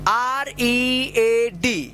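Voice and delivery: each letter held long; drawn-out, separate letters; slow spelling. Shown as a demonstration. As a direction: spell it very slowly for learners. Teacher spells out word for learners.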